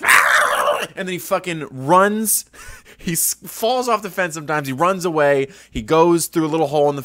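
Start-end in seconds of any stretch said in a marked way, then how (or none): 0.0-0.9 an unvoiced startled cat hiss; breathy startled hiss like the cat. Host imitates the stray cat's startled hiss. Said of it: "And he goes".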